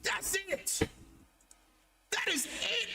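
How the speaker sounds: thrilled, high-pitched exclamation; excited eureka. Real, not acted. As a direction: pitch leaps up in delighted certainty. Hits on the perfect nickname.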